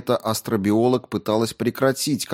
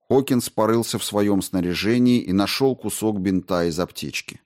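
usual speaking voice

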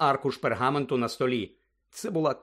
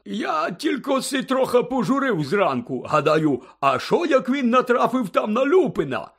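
high, slow, boozy storytelling; merry drunken character voice, high and drawn out; tipsy Hagrid, joyful; 9 semitones higher, 4 dB louder, slower than usual. A narrator's reading. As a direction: ramble happily like a big tipsy friend. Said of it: "радісно позираючи довкола".

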